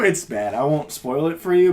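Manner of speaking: ordinary delivery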